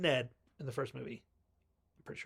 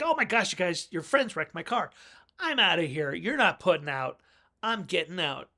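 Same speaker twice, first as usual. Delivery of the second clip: higher, louder huffy rant; imitating an upset country boy; upset outburst; 5 semitones higher, 7 dB louder than usual. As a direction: storm off in a huff. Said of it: "He's like".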